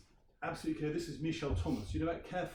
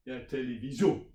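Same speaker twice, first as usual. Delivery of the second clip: "télévision" with the last syllable stressed and louder; stress on the end; 6 dB louder than usual. Shown as a demonstration. Teacher demonstrates French word stress, last syllable louder. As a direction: punch the final syllable of "télévision". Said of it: "you put the stress on the end".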